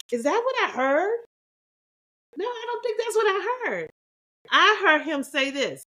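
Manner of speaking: high, incredulous questioning; can't believe what she heard, voice up; disbelieving. Retold or imitated. Wife doubting what she heard, high and incredulous.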